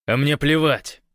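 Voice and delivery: high, loud, defiant retort; curt dismissal. A narrator's reading. Гош dismisses his comrade's worry, curt and defiant. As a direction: cut the objection off angrily. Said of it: "отрезал Гош".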